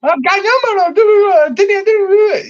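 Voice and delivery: very high, loud mock-Geordie babble; Geordie accent. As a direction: squeal it as an exaggerated, garbled Geordie. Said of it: "if I try and do a Geordie accent".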